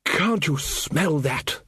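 slow, drawn-out relish; savouring wizard. Acted. Ridcully to companions on arriving in Lancre, slow relish. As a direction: stretch the words out, drinking in the air.

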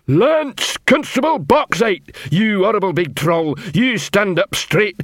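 high, hectoring troll sergeant; bully the recruit like a drill sergeant in broken English. Acted. New troll constable ordering a troll recruit about, hectoring.

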